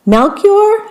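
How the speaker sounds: high hopeful guess. A narrator's reading. Queen guessing.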